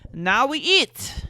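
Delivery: put-on high, loud, slow French accent; heavy French accent. Boyfriend announcing the meal, heavy French accent. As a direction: lean hard into a French accent, stretching it. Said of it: "heavy French accent".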